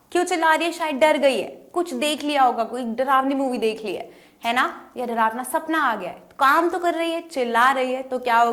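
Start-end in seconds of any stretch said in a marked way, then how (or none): none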